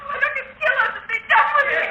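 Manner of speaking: a terrified captive sobbing and screaming at the killer. Acted. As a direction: break down screaming at him. Desperate captive begging for death.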